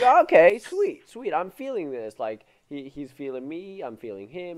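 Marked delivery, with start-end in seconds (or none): 0.0-2.2 high-pitched delighted yelp; hammed-up glee at good news; mock excited. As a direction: squeal with relief, way up high. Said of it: "I'm like".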